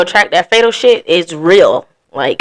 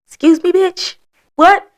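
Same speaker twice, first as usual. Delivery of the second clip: high-pitched, offended double take; affronted disbelief; 11 semitones higher, slower than usual. Acted. Jilted ex hearing about the new girl, affronted disbelief. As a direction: squeak out your disbelief.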